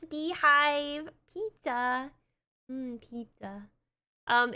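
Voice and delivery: ordinary talk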